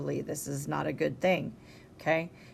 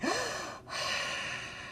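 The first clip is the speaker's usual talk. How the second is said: heavy breathing; 10 dB quieter than usual. Shown as a demonstration. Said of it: "Now I am heavy breathing for you".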